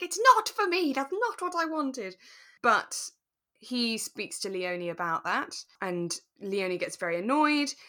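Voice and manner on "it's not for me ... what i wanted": higher and louder, imitating a frustrated dad; imitating Robert frustrated with his daughter, pitched up. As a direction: voice the dad's exasperation, pitched up. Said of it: "you must be so frustrated. Be like".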